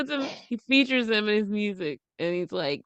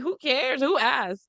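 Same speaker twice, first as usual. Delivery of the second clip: ordinary talk, nothing marked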